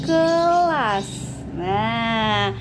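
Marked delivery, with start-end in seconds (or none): 0.1-1.4 KELAS stretched, with a long held first syllable; sounded-out word. Shown as a demonstration. Teacher shows children how it reads, KELAS drawn out. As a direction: draw out "ke" and join it to "las". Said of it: "Jadi kalau dibaca jadinya".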